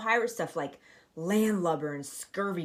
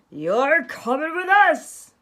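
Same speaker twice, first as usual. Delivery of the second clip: higher, louder, slow pirate command; pirate captain; 8 semitones higher, 10 dB louder, slower than usual. Acted. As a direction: order it like Braidbeard. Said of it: "Braidbeard told them".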